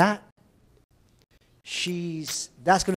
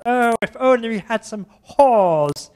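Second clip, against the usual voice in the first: put-on French accent; 7 semitones higher, 8 dB louder than usual. Teacher imitates the French student in a slow, loud French accent. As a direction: ham up the French student's slip. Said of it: "the student was French".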